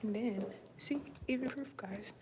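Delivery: hushed whisper pointing something out. Real, not acted. Showing viewers the proof, whispering. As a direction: whisper as if not to be overheard.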